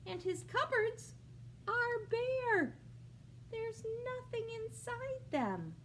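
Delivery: high, sorry-for-him lilt; mock dismay. A narrator's reading. Reading to a child, high mock dismay. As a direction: sound sad for poor Bear. Sad cupboard reveal, pitched high.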